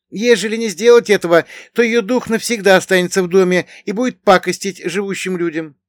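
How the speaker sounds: high, loud character voice; Bogdan, explaining villager. A narrator's reading. Bogdan explaining to his companion why roofs are dismantled, high-voiced villager.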